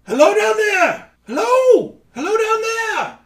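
very high, loud calling voice from above; high squeaky weasel hollering down a tunnel; weird voice. Acted. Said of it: "you hear a voice from the top of the tunnel. It's kind of a weird voice".

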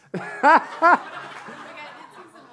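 high, loud laughter. Real, not acted. Preacher laughing at his dizzy volunteer, loud high laugh.

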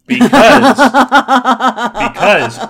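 high, loud, slow and laughing; laughing indignation. Real, not acted. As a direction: accuse with a laugh breaking through.

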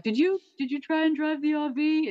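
lightly voiced Finch asking if he drove the RV; mock Finch scolding. Finch catching the robot who crashed the RV, mock accusing.

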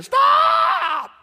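high shouted stop; wife screaming. Retold or imitated. Wife screams at husband backing trailer, high shout. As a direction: yell stop in alarm. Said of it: "my wife's screaming".

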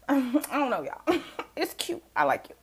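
high, giggly gushing; giggling. Real, not acted.

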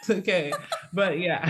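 ordinary talk

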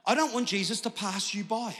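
usual speaking voice

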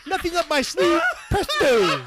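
high, loud cartoon moose with a laugh in it; goofy cartoon moose, laughing through it; Bullwinkle voice. Recruit doing a laughing, loud cartoon moose voice. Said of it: "I did fucking Bullwinkle".

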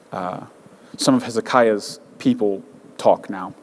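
normal talking voice

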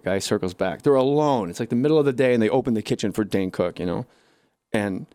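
ordinary talk

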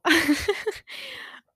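cracking up at her own joke; high laugh. Real, not acted. Podcaster laughs at her own meme quote, high and loud. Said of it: "We did it, Joe".

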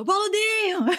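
a stranger's high shout of Boludinho; shouted name. Retold or imitated. Woman on the street shouting at the cyclist, high call. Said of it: "uma mulher gritou".

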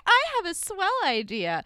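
a cheesy, overeager pitch; mock eager intern. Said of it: "jump in there and be like".

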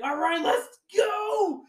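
high slow rallying cry; eager battle cry; pumped. Retold or imitated. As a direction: hype yourself up to charge. Charging into the mobs.

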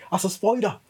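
put-on "spider" as "spoider" in Suffolk accent. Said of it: "my Suffolk accent would come on".

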